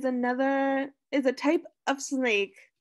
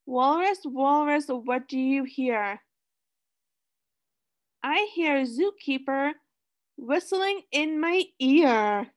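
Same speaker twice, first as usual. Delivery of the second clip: sing-song storybook reading; reading to children. Acted. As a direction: read it like a picture book for kids. Reading picture book to class.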